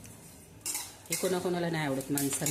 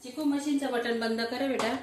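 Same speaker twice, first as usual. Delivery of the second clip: calling out; 7 semitones higher, 3 dB louder than usual. Real, not acted. Mother asking child to switch off machine, calling out. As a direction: call across the house.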